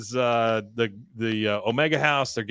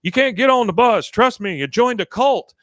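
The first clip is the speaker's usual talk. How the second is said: pleading shout; 10 semitones higher, 7 dB louder than usual. Retold or imitated. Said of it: "He goes".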